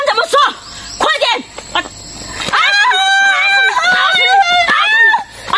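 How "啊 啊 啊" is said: long high shrieking yell; piercing angry scream; furious scream. Acted. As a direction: scream at her with full rage. Being robbed, attacking the suspect.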